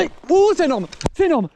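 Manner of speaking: high, loud burst of excitement; excited shout. Real, not acted. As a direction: yell it out, thrilled by the catch. Huge fish sighted, shouting.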